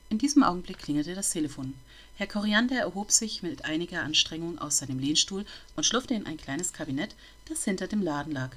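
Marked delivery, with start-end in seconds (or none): none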